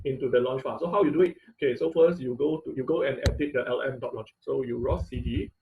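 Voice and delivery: ordinary delivery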